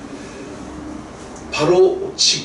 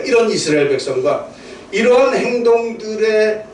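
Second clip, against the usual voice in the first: usual speaking voice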